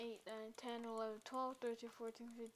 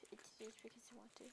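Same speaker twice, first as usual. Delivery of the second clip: fast, breathy whispered excuse; whispered aside; 15 dB quieter, much faster than usual. Real, not acted. Apologising to viewers for the accent, a quick whisper. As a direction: mumble it quickly under the breath.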